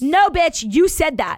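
loud. Real, not acted. Playful accusation.

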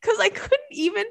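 high squeak through laughter; laughing. Real, not acted. Couldn't even explain what was going on, laughing. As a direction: still cracking up, voice pushed way up. Laughing while telling the story.

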